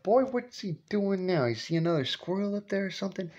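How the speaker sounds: a grandma puzzling from the window; grandma calling. Retold or imitated. Grandma at the kitchen window wondering what he's doing.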